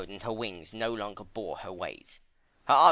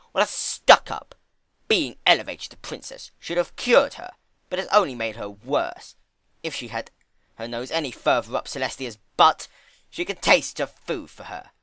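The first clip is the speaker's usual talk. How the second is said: scornful Discord; 3 semitones higher, 7 dB louder than usual. Acted. Discord mocks Twilight.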